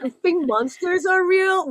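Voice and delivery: hammed-up disbelief; mock ridicule. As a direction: play a parent scoffing at a child's fear. Acted parent ridiculing the scared child.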